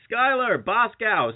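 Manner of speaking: high, drawn-out mock call; yelling across street. Calling random names to nobody across the street, mock yell. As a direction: imitate hailing someone far away, pitched high. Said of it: "I just yell across the street".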